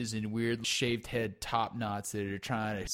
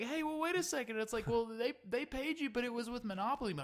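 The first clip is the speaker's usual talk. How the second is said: much higher, thin questioning voice; mock squeaky guest; 12 semitones higher, 5 dB quieter, faster than usual. Baffled guest questions the fake money, high and squeaky. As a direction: squeak out the complaint like a baffled diner.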